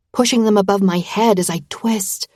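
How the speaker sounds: plain reading voice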